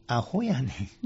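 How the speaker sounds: アホやねん in relaxed Kansai dialect, soft and playful. Shown as a demonstration. Monk showing the host the Kansai phrasing: teasing Kansai dialect. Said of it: "関西風に言うてみましょうかね".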